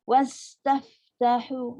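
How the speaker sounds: slow wastaftahu with breath on s, f and h; airy release. Shown as a demonstration. Teacher demonstrating airy letters. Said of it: "ini semua huruf angin".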